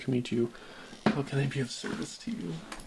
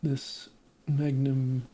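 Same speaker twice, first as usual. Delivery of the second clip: ordinary talk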